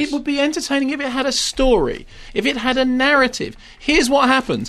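high-pitched, indignant insistence; exasperated rebuttal. Real, not acted. Critic rebutting a listener who liked Silent Hill, exasperated. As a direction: argue back, thoroughly exasperated.

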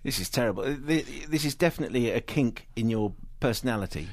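soft, low, clipped English actress voice; Gwyneth Paltrow impression. Critic imitating the actress for the host, soft and low. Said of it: "the Gwyneth Paltrow performance".

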